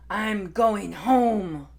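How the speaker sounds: loud exasperated roar with a growl; furious dinosaur. Acted. Hungry Tai gives up on lunch, loud furious roar. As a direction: storm off with a roar.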